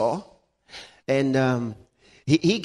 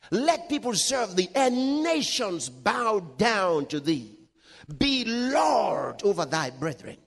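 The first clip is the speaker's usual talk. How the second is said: raised, high-pitched pulpit declamation; fervent preaching; 6 semitones higher than usual. Real, not acted. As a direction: proclaim it with authority, stretching the blessing. Preaches, then declaims the blessing.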